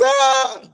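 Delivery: high startled "ah"; startled cry. Real, not acted. Host finds the call ran five hours, high cry.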